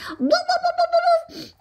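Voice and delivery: high yappy barks; puppy barking. Acted. Child imitating the cute puppy, high little woofs. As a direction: bark like a tiny puppy.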